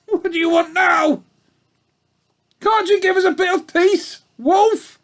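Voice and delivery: very high, loud sour sheep voice; old ewe telling off the wolf; grumpy old ewe. Acted. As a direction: snap at the intruder like a cross old ewe.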